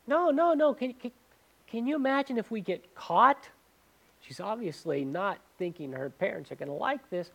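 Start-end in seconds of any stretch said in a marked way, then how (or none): 0.1-3.5 higher, louder, panicked refusal; high panicky girlfriend. Retold or imitated. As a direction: squeal out the girl's worried no. Girl refusing kiss, horrified.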